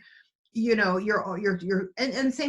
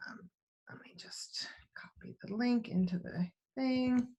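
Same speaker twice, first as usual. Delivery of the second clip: whispered aside; 2 semitones higher, 9 dB quieter than usual. Real, not acted. Muttered aside while copying link.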